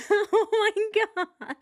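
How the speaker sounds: high, slow words broken by laughter; can barely get it out for laughing; laughing hard. Real, not acted. Reacting to the clip, laughing hard through her words.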